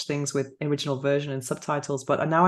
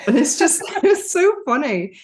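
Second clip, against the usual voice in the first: laughing; 9 semitones higher, 5 dB louder than usual. Real, not acted. Laughing about dubbing porn.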